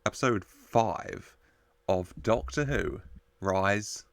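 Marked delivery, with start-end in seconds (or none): none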